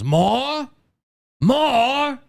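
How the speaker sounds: high, loud, drawn-out disbelief; indignant Oliver Twist master. Retold or imitated. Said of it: "and then the guy goes".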